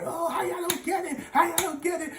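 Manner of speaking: high exasperated scolding; high-pitched teacher rant; mock teacher yell. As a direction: shout it at the class, hammed-up. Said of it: "screaming, yelling, mad".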